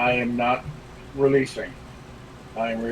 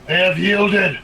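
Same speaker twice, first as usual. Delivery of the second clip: loud, raised call to the group; shouted announcement; 6 semitones higher, 6 dB louder than usual. Acted. Player informing the group mid-fight, raised shout. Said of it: "just shout that out".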